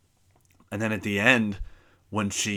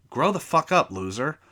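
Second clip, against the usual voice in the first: higher, louder insult; angry outburst; 6 semitones higher, 4 dB louder than usual. Real, not acted. Insulting the teacher now.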